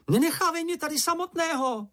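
very high, pleading wail; panicky cry. A narrator's reading. Čenich begs not to be left alone, panicky high cry. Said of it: "vykřikl Čenich".

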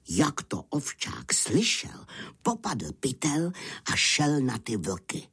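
usual speaking voice

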